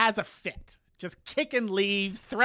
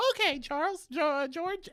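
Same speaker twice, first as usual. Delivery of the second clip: lightly mocked Charles; 10 semitones higher than usual. Charles voiced agreeing in recap.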